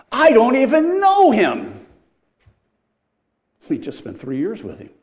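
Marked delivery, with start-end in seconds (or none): 0.1-1.9 high, loud, drawn-out shout of denial; vehement denial. Acted. Preacher voices Peter denying he knows Jesus, vehement shout. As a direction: protest loudly, stretching the key word.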